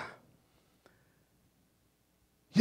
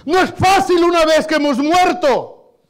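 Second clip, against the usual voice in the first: louder, higher preaching voice; impassioned preaching; 6 semitones higher, 7 dB louder than usual. Real, not acted. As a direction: preach it with rising fervor. Predica con fuerza. (predicación ferviente)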